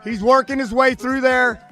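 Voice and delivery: high, loud race call; excited play-by-play. Real, not acted. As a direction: call the finish building up.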